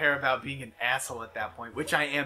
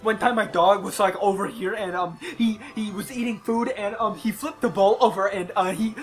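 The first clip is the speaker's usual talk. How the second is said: louder, higher rambling story voice; chatty stranger; 5 semitones higher, 6 dB louder than usual. Retold or imitated. Stranger telling a dull dog story, loud and higher. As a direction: ramble on like a proud dog owner.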